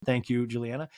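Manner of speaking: usual speaking voice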